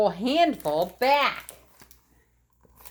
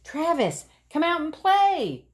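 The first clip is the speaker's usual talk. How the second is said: calling out; 10 semitones higher, 3 dB louder than usual. Acted. Calling to a friend.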